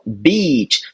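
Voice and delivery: "beach" slow, long drawn ee. Shown as a demonstration. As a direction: draw out the ee in "beach". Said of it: "E a outra é praia".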